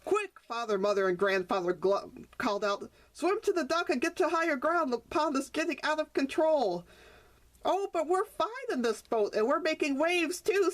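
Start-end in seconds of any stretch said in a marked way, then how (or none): 0.0-0.4 high urgent shout; urgent call. Acted. Parents call alarm. 3.1-6.8 high urgent warning; urgent call. Acted. 7.6-10.7 high joyful carefree; joyful croak. Acted. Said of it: "croaked out in joy".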